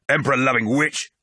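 high, hostile jeer; shouted insult. A narrator's reading. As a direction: hurl the insult at an enemy. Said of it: "someone shouted".

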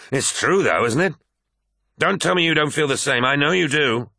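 high-pitched, pressing accusation; heated insistence. A narrator's reading. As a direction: push the friend to admit it. Abaddon presses his angry point.

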